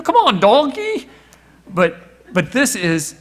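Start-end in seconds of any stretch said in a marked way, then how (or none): 0.0-1.1 a hint of Shrek, high and loud. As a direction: call out a lightly voiced Shrek. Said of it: "I wish I could do the Shrek voice".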